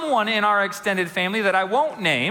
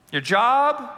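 ordinary talk

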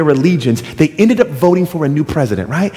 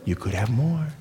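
low, much softer, coaxing; seductive tempter; 5 semitones lower, 11 dB quieter than usual. Acted. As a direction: lure them in quietly. Tempter repeats lure.

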